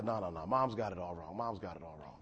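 low, lightly voiced sulky kid; a hint of a mumbling teen. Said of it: "He was like".

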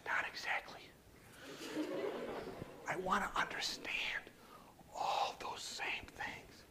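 soft whisper in slow, separated phrases; whispered explanation. Retold or imitated. Telling the patient he wants to be nice, whispered. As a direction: whisper it gently, pausing between phrases. Retelling own answer to patient.

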